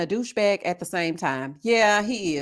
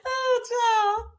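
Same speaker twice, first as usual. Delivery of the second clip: laughing; 13 semitones higher than usual. Real, not acted. After big laugh.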